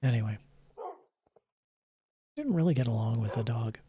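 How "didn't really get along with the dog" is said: ordinary talk, nothing marked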